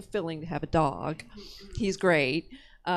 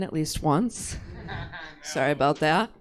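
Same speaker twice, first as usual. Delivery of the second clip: ordinary talk, nothing marked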